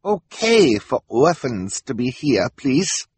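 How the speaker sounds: high, slow, put-on foreign voice; fake broken English. A narrator's reading. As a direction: draw it out like a schemer faking poor English. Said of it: "pretending that he could not speak English properly".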